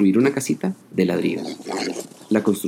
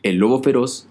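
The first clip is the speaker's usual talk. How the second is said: ordinary delivery, nothing marked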